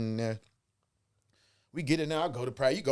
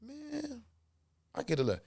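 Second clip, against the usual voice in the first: ordinary delivery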